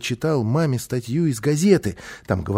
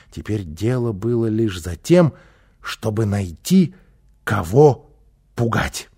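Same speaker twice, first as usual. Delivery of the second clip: usual speaking voice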